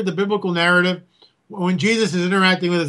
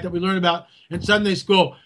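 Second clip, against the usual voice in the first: ordinary talk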